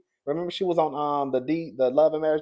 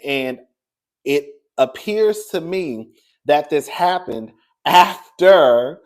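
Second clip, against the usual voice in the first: ordinary delivery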